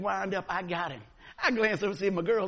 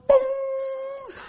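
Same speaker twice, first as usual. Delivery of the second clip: a sudden shouted boom, pitched way up; high crash yell; 8 dB louder than usual. Retold or imitated. Catcher telling the church about the collision, loud shouted boom. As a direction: make the crash sound with your whole voice.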